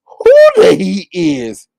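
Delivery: loud, high mock-whining denial; Kimmy. Kimmy denies knowing the Heat, exaggerated whiny mimicry. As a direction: wail it slowly, stretched out in mock innocence. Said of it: "Kimmy got to be like".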